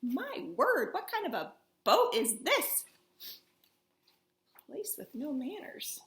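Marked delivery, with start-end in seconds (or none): none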